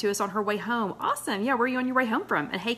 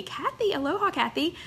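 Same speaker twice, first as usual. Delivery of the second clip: excited greeting; 8 semitones higher than usual. Real, not acted.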